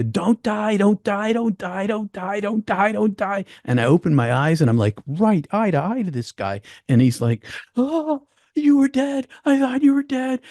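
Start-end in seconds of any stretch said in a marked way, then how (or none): 0.0-3.4 high, desperate pleading, near tears; crying plea. Retold or imitated. Climbing partner begging his friend not to die, high crying plea. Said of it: "And then it's crying and yelling". 7.8-10.4 high, crying relief; tearful relief. Retold or imitated. Partner crying with relief his friend lives, high tearful voice. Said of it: "he's crying".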